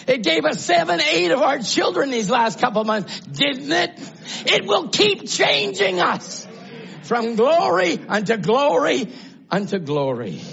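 high shouted testimony, rising to a peak; climactic preaching. Real, not acted. Preacher telling the church what quoting the Word does, climactic preaching.

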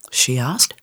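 usual speaking voice

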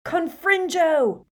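high, loud, slow blasting incantation; yelled spell. Acted. Harry blowing up the sidecar, slow loud yell. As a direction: fling the curse out with full force. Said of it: "and yelled".

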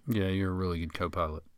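dry, sarcastic praise. Real, not acted. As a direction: say it with a sarcastic edge. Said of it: "You said that sarcastically".

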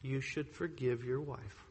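a gentle, hushed voice from within; still small voice. Retold or imitated. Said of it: "The still small voice said".

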